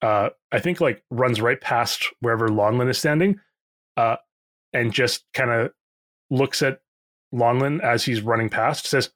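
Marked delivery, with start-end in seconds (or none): none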